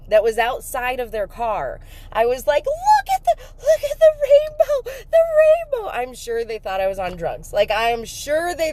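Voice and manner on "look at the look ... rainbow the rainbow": high, loud tearful cry. Retold or imitated. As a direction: shout it through tears at strangers. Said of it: "ugly running".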